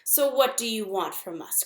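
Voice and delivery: ordinary delivery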